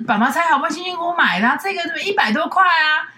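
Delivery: high-pitched scolding mother voice; nagging mom. Retold or imitated. Daughter keeps mimicking mom's guilt-trip over the lid, high nagging voice. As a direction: whine about how hard dad worked to buy it. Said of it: "各种这种".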